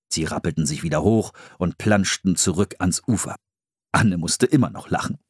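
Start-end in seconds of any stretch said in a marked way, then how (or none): none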